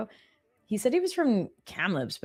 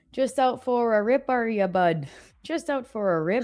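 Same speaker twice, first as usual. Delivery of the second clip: higher-pitched imitated Canadian accent; 5 semitones higher than usual. Quoting a Canadian singer's line to show the accent, mimicked Canadian accent. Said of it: "like, Canadian accent".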